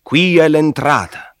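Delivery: high, loud barked order; shouted command. A narrator's reading. Ferryman ordering the travellers off the boat, loud shout. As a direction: yell it across the water. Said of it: "ci gridò".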